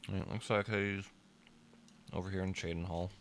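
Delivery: talking while chewing; words round a bite of food; mouth full. Real, not acted. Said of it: "I'm chewing with my mouth full".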